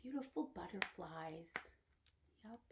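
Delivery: hushed wonder; whisper. Real, not acted. Teacher whispering about butterflies to children. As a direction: whisper it in awe.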